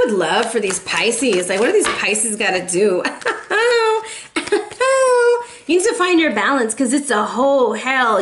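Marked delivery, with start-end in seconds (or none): 3.1-5.4 high laugh. Real, not acted. Laughs loudly.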